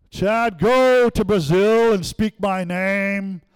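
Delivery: loud, high, slow divine command; booming God voice. Acted. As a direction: proclaim it grandly like God from on high. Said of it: "We're expecting that kind of thing".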